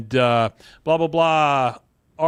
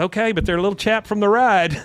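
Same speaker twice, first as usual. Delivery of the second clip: high, louder mock-female reply; imitated biker woman; 8 semitones higher, 5 dB louder than usual. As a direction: pitch it up as the obliging woman.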